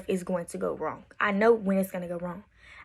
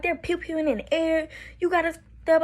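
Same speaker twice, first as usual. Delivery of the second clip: retold angry yelling; 7 semitones higher than usual. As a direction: imitate yelling at him, pitched high. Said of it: "crying, I'm yelling at him".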